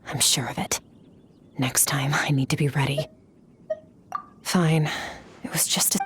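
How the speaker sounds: low private whisper, half to herself; breathy murmur, pitch dropped low. Acted. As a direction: hush it, a secret thought. Muttering to herself alone.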